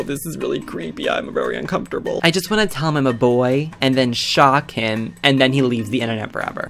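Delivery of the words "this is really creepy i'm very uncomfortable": whispered aside. Real, not acted. Player whispering how uncomfortable the chat is, hushed whisper. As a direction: breathe it out uncomfortably.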